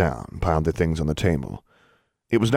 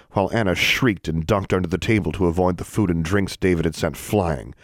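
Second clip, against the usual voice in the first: usual speaking voice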